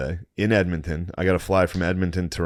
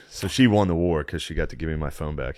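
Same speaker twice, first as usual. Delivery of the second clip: ordinary delivery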